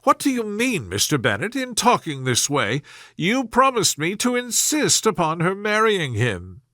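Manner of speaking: a wife feeling betrayed, voice shooting up; indignant mother. A narrator's reading. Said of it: "was excessively disappointed".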